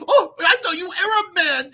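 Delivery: high, quick put-on Filipina voice; high mock Filipina accent, startled; imitating Filipina. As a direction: squeak it like a startled woman backing off. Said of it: "she went".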